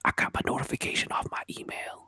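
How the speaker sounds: mock ASMR whisper. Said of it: "you gotta whisper".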